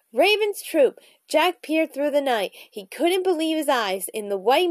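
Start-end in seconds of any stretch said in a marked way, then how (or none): 0.1-1.0 higher-pitched raven announcement; loud croak. A narrator's reading. Teddy spotting the ravens in flight, mild croaked call. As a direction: call it out high. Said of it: "let out a loud croak".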